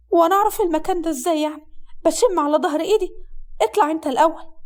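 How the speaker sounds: put-on high-pitched, sarcastic young woman; sassy young bride.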